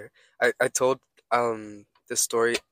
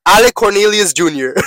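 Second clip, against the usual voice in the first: mock announcement; 8 semitones higher, 14 dB louder than usual. Loud mock-formal name announcement.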